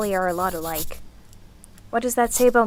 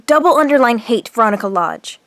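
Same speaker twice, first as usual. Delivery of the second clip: louder, with hate punched hard; emphatic hate; 7 dB louder than usual. Acted. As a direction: hammer the word hate. Furious diary entry about rival.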